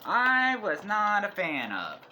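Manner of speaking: high, slow and loud for emphasis; emphatic disapproval. Real, not acted. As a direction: stretch it out, clearly unimpressed. Panning the last movie.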